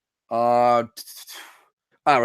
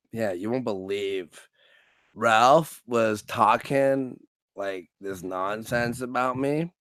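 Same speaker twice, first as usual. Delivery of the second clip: mock self-pity; 6 semitones lower, 5 dB quieter, slower than usual. Acting himself complaining to coach.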